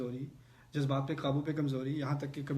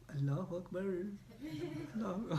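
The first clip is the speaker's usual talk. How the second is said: mock call to prayer; 3 semitones higher, 6 dB quieter, much slower than usual. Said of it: "گھور گھور کے ایسے".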